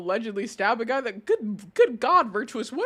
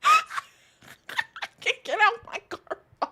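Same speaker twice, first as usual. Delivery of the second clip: mock whiny drunk girl; 13 semitones higher, slower than usual. Mocking the arrested girl.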